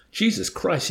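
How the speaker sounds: understated scream of disbelief; tortured amazement. A narrator's reading. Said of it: "screamed at Arfie in tortured amazement".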